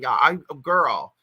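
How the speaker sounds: normal talking voice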